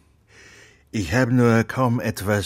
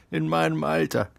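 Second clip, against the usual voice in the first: laughing it out, higher; amused laugh at the idea of a child; 8 semitones higher than usual. Acted. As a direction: chuckle through the words. Geppetto laughs at wish idea.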